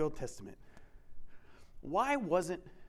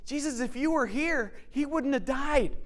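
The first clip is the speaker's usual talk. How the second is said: hurt reproach; 8 semitones higher, 4 dB louder than usual. Retold or imitated. Martha reproaching Jesus.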